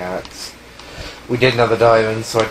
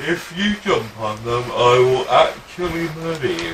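mock threat; 5 semitones higher than usual. Mock threat over the farm.

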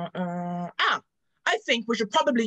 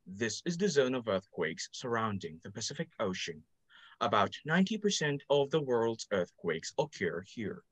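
lower, softer narrator voice; documentary narrator; 12 semitones lower, 6 dB quieter than usual. Acted.

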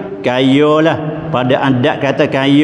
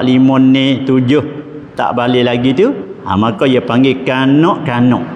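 ordinary delivery

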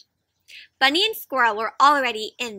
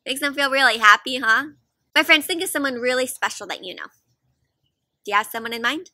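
ordinary delivery

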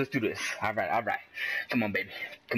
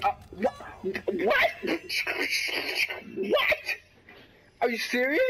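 very high, cracked-up squeal of disbelief; outraged disbelief; 12 semitones higher, slower than usual. Real, not acted. Player who just died in the game, outraged disbelief. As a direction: squeal it, stunned and indignant.

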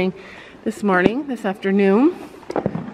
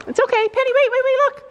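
much higher, coaxing sing-song to calm a skittish horse; high soothing pony voice; 12 semitones higher, faster than usual. Real, not acted. Owner coaxing her nervous pony, high soothing coo.

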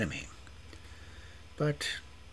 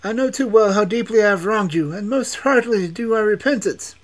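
much higher and louder pleading; repentant prince; 12 dB louder than usual. A narrator's reading. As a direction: beg forgiveness, high and loud.

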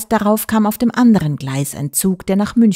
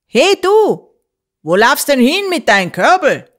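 much higher and louder call across the station; rough shout of a station man; 7 semitones higher, 5 dB louder than usual. A narrator's reading. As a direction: yell out at the running child. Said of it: "schrie mich einer an".

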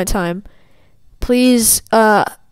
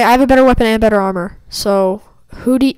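ordinary delivery

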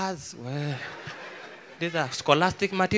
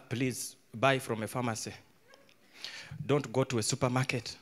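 normal talking voice

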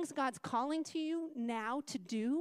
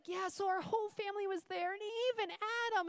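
put-on higher, eager little boy; child voice; 8 semitones higher than usual. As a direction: lift up into a boy's voice. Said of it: "he's like".

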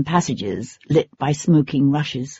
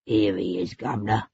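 lower, softer grudging jailer voice; 8 semitones lower, 9 dB quieter than usual. A narrator's reading. Jailer reluctantly hands the boy to the lord, grudging. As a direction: hand the boy over reluctantly, low. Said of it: "said the jailer grudgingly".

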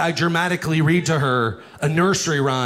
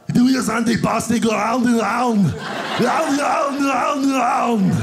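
Schwarzenegger voice; 7 semitones higher than usual. Acted. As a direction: bark the German like Arnold. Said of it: "Arnold Schwarzenegger".

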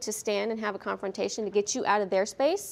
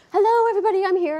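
higher and much louder, bouncy greeting; excited puppy voice; 10 semitones higher, 7 dB louder, faster than usual. Retold or imitated. Instructor voicing the Rottie puppy greeting everyone, excited. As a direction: burst in like an eager young dog. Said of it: "he's a typical nine-month-old Rottie".

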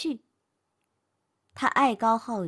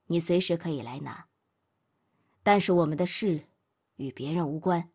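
much lower, icy and detached; cold; 6 semitones lower than usual. A narrator's reading. Man coldly ending things with his girlfriend. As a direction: shut her out with ice in your voice. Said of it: "冷冷地看着他说道".

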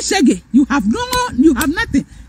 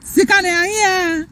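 mock crying; 3 semitones higher, slower than usual. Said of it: "mama bi ka kyerɛ me say".

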